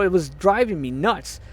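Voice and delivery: normal talking voice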